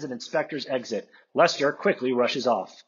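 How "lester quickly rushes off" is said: normal talking voice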